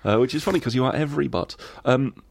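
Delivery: ordinary talk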